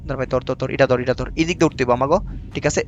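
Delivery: low, soft urging so the monster won't hear; hushed, tense game urging. Real, not acted. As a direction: keep it down, coax quietly. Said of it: "sound করন যেত না".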